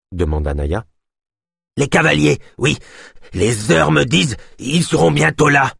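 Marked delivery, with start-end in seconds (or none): 1.8-5.7 high, agitated character voice; urgent high-pitched alarm; alarmed warning. A narrator's reading. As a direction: announce the coming danger, pitch raised. Yosp keeps raising the alarm.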